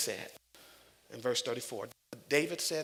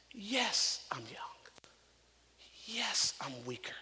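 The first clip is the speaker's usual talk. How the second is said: whispered confession; 4 dB quieter, slower than usual. Pretend. Voicing a believer's confession.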